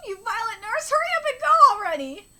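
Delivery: high, loud acted yell; loud, high manga-character outburst; exasperated shout. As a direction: shoo them away at full pitch. Acts child shouting at the nurse.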